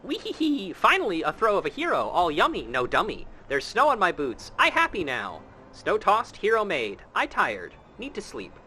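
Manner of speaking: put-on high, childlike creature voice, bouncing pitch; goofy snowman voice. Streamer voicing talking snowman to the player, high goofy. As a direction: play a simple greedy snowman begging for throws.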